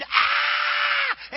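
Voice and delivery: high, loud shriek of Potiphar's wife; scream. Retold or imitated. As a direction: let out a sudden high scream. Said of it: "And she screamed".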